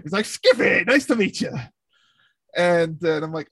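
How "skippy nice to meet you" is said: put-on higher, lively Newfoundland accent; mock Newfie accent, pitched up; imitated Newfie kid. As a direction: greet like an eager Newfie kid. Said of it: "he sounds like he's, like, a newfie".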